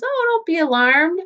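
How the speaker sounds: breezy, high, self-assured reassurance; cocky and confident. Shown as a demonstration. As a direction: brush off the danger with a cocky lift. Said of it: "He says it very reassured, very confident".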